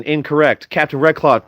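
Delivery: normal talking voice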